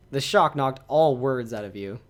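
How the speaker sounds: normal talking voice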